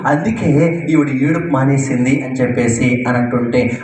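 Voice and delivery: usual speaking voice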